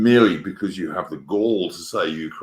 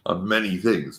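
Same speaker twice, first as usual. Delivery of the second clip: normal talking voice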